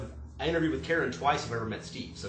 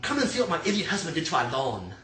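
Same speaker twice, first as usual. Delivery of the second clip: bad English accent; 5 semitones higher than usual. Wife calls staff over to see husband's hole, bad English accent. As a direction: yell it in a terrible English accent. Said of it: "That was my terrible accent".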